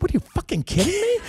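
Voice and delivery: high, squeaky retold disbelief; stunned disbelief. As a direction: shriek it like you can't believe the news. Retelling disbelief at getting lead.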